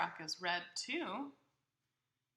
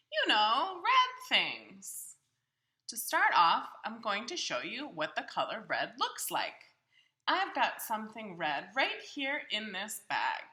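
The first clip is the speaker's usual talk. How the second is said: higher, louder, cheerful Big Bird puzzling over his bag; 3 semitones higher, 8 dB louder than usual. Acted. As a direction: play a big friendly bird talking to the child. Reader voicing Big Bird's lines.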